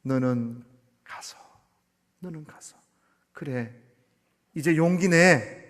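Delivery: gentle voice of God. Acted. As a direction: calmly encourage a frightened man. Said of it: "하시는 말씀이".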